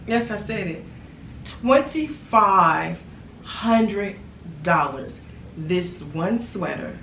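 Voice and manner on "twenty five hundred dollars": "Twenty-five" slow and loud, each word split apart; emphatic price. Real, not acted. Announcing the store price, slow and emphatic. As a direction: spell out "Twenty-five hundred dollars" word by word.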